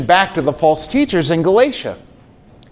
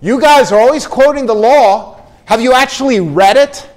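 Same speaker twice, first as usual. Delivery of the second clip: higher, louder exasperated rebuke; incredulous, louder scolding from the pulpit; reprimanding Paul; 5 semitones higher, 4 dB louder than usual. Retold or imitated. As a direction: scold them for never reading it. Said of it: "Paul saying something like this".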